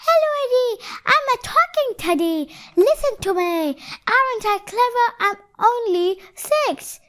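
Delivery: high singsong teddy voice; high, playful toy voice; talking teddy. A narrator's reading. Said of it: "crouched down behind it, and said".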